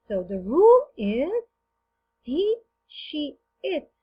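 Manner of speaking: slow teacher emphasis. Shown as a demonstration. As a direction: announce the rule slowly and firmly. Teacher stating grammar rule slowly. (بطء وتأكيد المعلم)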